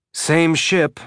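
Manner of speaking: "Same ship" said curtly, with a touch of surliness; surly. A narrator's reading. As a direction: clip "Same ship" off, mildly grumpy. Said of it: "feeling a little surly".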